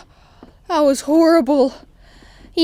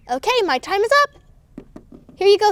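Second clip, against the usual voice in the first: ordinary delivery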